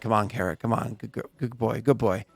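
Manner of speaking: soft low coaxing murmur; coaxing horse. Acted. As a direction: gently urge the horse on. Rider coaxing his horse.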